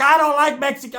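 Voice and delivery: high put-on cranky mom; lightly voiced grumpy mother; old mom voice. Roach's mom answering from inside, high grumpy voice.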